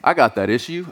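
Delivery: mock prepper with a hint of Southern accent. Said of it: "has to have a si-, Southern accent".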